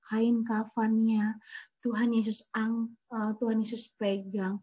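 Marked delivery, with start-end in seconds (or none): none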